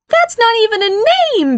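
very high incredulous protest; skeptical. Acted. Barry rejects mother's suggested name, skeptical outburst. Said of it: "shook his head skeptically".

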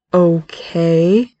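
drawn-out okay, rising at the end; slow, uncertain okay; bewildered, guarded. A narrator's reading. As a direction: stretch the word out in guarded confusion. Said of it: "father's bewilderment and guardedness".

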